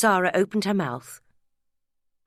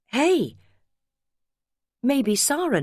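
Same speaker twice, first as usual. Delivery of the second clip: excited; 8 semitones higher, 3 dB louder, slower than usual. A narrator's reading. Romy's bright interjection.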